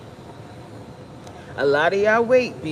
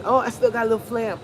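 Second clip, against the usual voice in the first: mock excuse-making; 4 semitones higher, faster than usual. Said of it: "what we do is".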